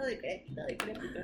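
unvoiced, trailing whisper. Real, not acted. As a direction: breathe the word away softly. Repeated word, trailing off.

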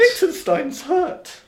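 hammed-up hurt. Playing wounded country.

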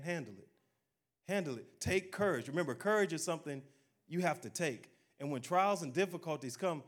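ordinary talk, nothing marked

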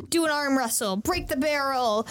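ordinary delivery

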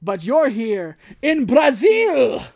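loud, slow gloat; slow loud mock villain taunt. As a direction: savour each word like a smug villain.

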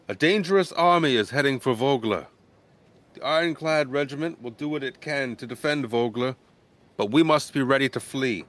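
the mayor warns the villagers from the stage; mayor's announcement. Acted. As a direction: announce the danger to the crowd. Mayor addresses the crowd.

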